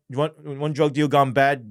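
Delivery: normal talking voice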